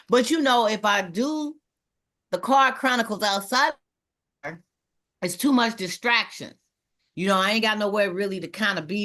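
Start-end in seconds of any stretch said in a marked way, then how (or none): none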